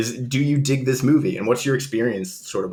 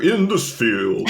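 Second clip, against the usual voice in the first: sportscaster voice; much slower than usual. Retold or imitated. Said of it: "you have, like, a sportscaster voice".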